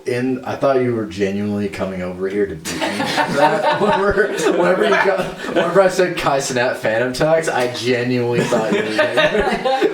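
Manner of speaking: high laughing explanation; giggling, voice up high. Real, not acted. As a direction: talk through giggles. Laughing while explaining fear of being hit.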